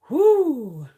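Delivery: high, loud, drawn-out whoo; emphatic whoo. Real, not acted. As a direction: let out a big whoo that slides down. Exclaiming over a crazy full moon.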